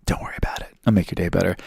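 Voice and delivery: whispered, then softly voiced. Delta agent waiving the bag fee, in a hushed whisper. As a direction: hush it, like a quiet favour. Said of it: "she, like, quietly goes".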